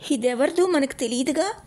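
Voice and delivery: put-on high, wondering child's question; innocent boy. A narrator's reading. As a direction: ask it wide-eyed like a naive little boy. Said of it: "అమాయకంగా అన్నాడు".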